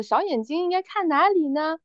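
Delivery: put-on high, slow singsong question to little children; teacher's lilting classroom question; first-grade teacher. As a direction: ask it like a primary teacher prompting the class, drawn out. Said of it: "他直到问了一个问题".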